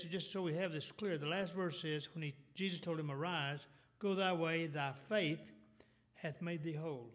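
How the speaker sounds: ordinary talk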